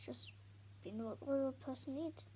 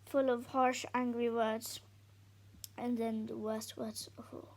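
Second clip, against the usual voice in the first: plain reading voice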